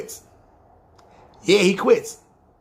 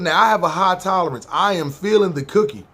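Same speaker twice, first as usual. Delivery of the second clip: ordinary talk, nothing marked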